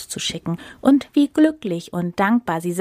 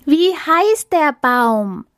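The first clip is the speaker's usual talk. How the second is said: high, loud, very slow cry; hungry animals shouting at the elephant; 7 semitones higher, 5 dB louder, much slower than usual. Acted. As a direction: shout it out, drawn long and high. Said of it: "schrien die Tiere wieder".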